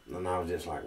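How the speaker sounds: usual speaking voice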